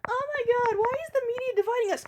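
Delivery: very high, rushed mocking voice; mock high-horse whining. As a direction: parody a sanctimonious complainer. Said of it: "get on your high horse about".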